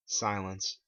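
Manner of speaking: ordinary delivery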